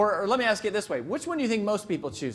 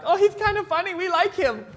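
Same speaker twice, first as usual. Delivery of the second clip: much higher, louder mock falsetto with a laugh; giggly ladies; 14 semitones higher, 7 dB louder than usual. Women in the audience charmed by the speaker, mock falsetto. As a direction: squeal it like delighted women in the audience. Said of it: "They're like".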